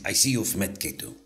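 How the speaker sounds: hint of an imitated Diego accent; Diego impression. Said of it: "I've been working on my Diego".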